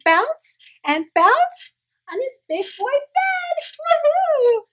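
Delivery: high, loud, slowed whoop of joy; gleeful bouncing. Acted. Kitten bouncing on his bed, high whooping glee. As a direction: squeal it as he bounces.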